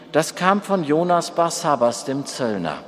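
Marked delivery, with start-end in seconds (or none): none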